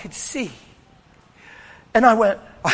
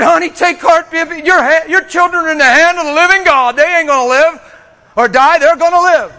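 louder, higher, fervent promise; loud, confident comfort to a mother; bold loud assurance; 7 semitones higher, 9 dB louder than usual. Retold or imitated. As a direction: declare it with full faith. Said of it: "If I didn't have the theology I had".

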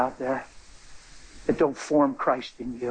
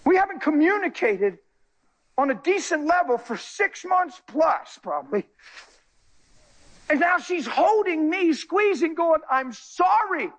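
emotional; 12 semitones higher than usual. Real, not acted. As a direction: voice raised high with emotion. Tearful testimony, voice high and strained.

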